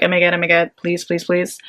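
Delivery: hushed, trembling prayer under the breath; scared pleading. Retold or imitated. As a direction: beg quietly, frozen in fear. Frozen still, terrified of moving.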